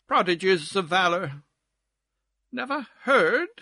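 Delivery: lilting whist-table gossip; lightly voiced society chatterer; chattering society card player. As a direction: exclaim over gossip between card plays.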